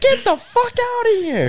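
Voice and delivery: loud, very high mock screech; hammed-up furious mom shrieking them away; mom freaking out. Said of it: "she started freaking out".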